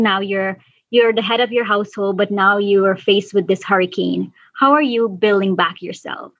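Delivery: ordinary delivery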